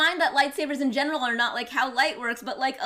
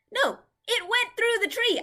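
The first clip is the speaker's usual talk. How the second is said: indignant disbelief; 9 semitones higher, 4 dB louder than usual. Real, not acted. Protesting a game kill.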